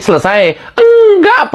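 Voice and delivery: ordinary delivery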